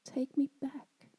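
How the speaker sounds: put-on understated childlike pleading; pleading cry. A narrator's reading. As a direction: beg slowly to be taken back. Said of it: "cried the little girl".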